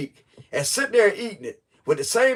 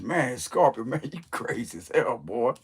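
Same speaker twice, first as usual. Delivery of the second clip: imagined onlooker; 6 semitones lower, 8 dB quieter than usual. Pretend. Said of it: "I can hear somebody behind the scenes".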